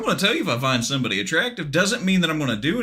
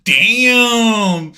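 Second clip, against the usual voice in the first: loud, high, drawn-out mock admiration; over-the-top appreciative shout; hammed-up lusting; 6 semitones higher, 8 dB louder than usual. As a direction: holler it out like you're floored by a beauty.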